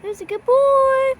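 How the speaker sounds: high, cooing pet praise. Real, not acted. Owner praises her parrot.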